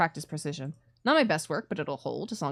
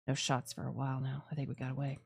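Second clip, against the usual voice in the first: hushed relief; 4 semitones lower, 9 dB quieter than usual. Acted. Relief after escape, hushed.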